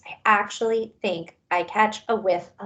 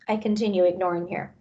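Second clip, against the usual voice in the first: usual speaking voice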